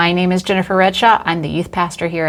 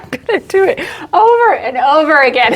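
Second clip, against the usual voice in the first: higher, teary laughing voice; 7 semitones higher than usual. Real, not acted. Host despairing of retakes, laughing through the words. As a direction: talk through real laughter.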